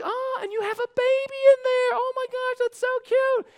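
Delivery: very high falsetto coo; gushing store worker. Retold or imitated. Lady spotting a baby in the customer's car, gushing. Clerk cooing over a baby.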